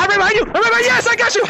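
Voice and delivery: high, excited gloating; gleeful chase taunt. Real, not acted. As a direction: crow over the catch, voice shooting up. Catching a player in tag.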